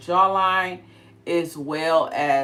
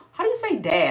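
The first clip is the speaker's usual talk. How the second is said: son's question; 13 semitones higher, 7 dB louder than usual. Retold or imitated. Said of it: "And he's like".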